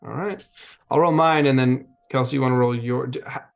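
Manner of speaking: ordinary delivery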